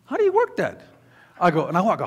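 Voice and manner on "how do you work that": put-on high, puzzled kid voice; mock puzzled son. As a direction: ask it like a baffled boy. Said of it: "He goes".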